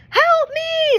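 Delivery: high loud desperate cry; waitress screaming as a knife appears; screamed plea. A narrator's reading. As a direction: shout it out in terror. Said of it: "screamed Ne-, Rita".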